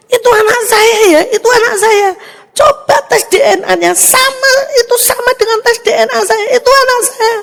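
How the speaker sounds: high, loud insistent plea; pleading mother. Retold or imitated. A mother begging nurses to let her see her baby, loud and high. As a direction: fight to be let in to your baby.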